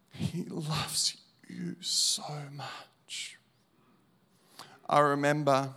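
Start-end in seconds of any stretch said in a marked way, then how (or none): 0.1-3.4 slow whispered preaching; a slow, hushed whisper. Acted. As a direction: breathe it softly to each listener. Preacher's final repeat, breathed out.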